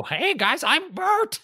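very high, goofy Midwestern guy voice; Bert. Acted. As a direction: squeak out a caricatured suburban introduction. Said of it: "I was gonna say Bert".

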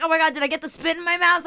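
high, fast fretful gasp; panicked. Retold or imitated. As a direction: rush it in disgusted panic. Narrator panicking over spit.

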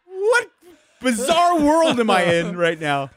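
high, loud, incredulous; bewildered disbelief. Retold or imitated. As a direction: sound baffled by the whole scene. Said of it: "I'm like".

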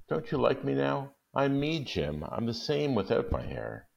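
wife pleading with husband about her hair; Della pleading. Acted. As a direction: plead with your husband to understand. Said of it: "said Della".

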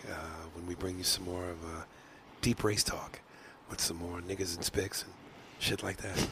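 soft, low radio host voice; smooth late-night DJ sign-off; ASMR voice. Acted. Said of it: "my easy listening".

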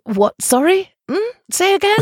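acted incomprehension, rising high on each question; baffled listener. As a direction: play someone who can't make out a word. Acts out not understanding Glaswegians.

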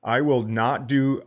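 normal talking voice

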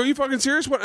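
high, rising retold retort; incredulous challenge. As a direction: throw it back in mock disbelief. Retells own retort to heckler.

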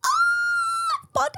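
squealed high shocked Ah, Boden; shocked cry. Retold or imitated. Reading mom's text, a high shocked squeal. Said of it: "I was like".

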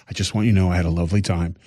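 syrupy Ned Flanders friendliness; nicest voice ever. Retold or imitated. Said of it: "in the nicest voice ever".

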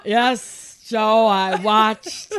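loud, hammed-up announcing of momentous news; excited phone calls. Husband phoning friends about the perfect game, loud and overwrought. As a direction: imitate an overwhelmed fan, projecting into the phone.

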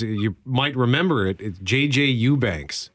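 usual speaking voice

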